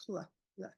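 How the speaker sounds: "Cl" as a short unvoiced consonant blend, no vowel; cl sound. Shown as a demonstration. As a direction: tap out "cl, cl" as a bare sound. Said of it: "what sound does the C L make".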